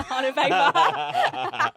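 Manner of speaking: slow words broken by real laughter; laughing. Real, not acted. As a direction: laugh it out as the joke lands. Laughing at her own joke.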